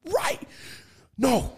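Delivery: very high, exclaimed imitation; mock heated argument. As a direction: squeal it in mock disbelief. Same acted film argument.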